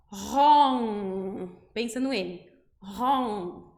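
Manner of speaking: "Ron" said ró with a nasal N after. Shown as a demonstration. Teacher adds a nasal to ró, says "Ron". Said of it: "eu vou colocar um N depois".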